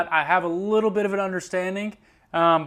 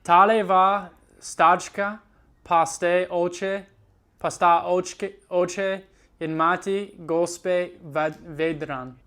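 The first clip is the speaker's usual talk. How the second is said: slow, halting reading aloud in Slovene; slow careful reading; slower than usual. Acted. Student reads Charlie and the Chocolate Factory aloud, slowly. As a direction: read each word out slowly, syllable by syllable.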